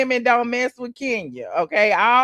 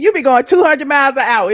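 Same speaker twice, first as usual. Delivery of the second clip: ordinary delivery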